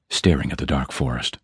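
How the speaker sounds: plain reading voice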